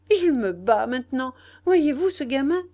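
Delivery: high, loud outrage; indignant scolding. A narrator's reading. Nana, grabbed by the boy, protests indignantly. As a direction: exclaim at the cheek of it.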